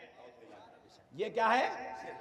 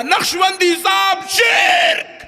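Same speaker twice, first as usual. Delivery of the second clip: high shout of gotcha; triumphant shout; 8 semitones higher, 6 dB louder, slower than usual. Retold or imitated. Opponent shouting at the cleric at the shrine, triumphant shout. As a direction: yell it like catching him out.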